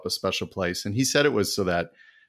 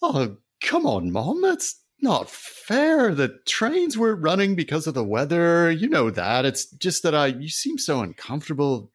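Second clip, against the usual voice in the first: exasperated son; 8 semitones higher than usual. Acted. Son defends missing his mother's birthday, high and exasperated. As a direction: protest like a scolded grown son.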